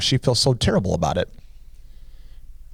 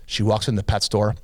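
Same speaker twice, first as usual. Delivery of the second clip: ordinary talk, nothing marked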